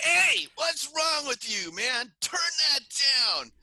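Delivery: high, loud mock yell of an angry neighbor lady. Neighbor lady scolding Jerry over loud drums, high mock yell. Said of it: "starts yelling at him".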